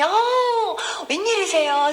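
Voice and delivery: high, exclaimed mock surprise; delighted surprise. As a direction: greet an unexpected visitor with an imitated gasp of delight. Deacon greets surprise visitor.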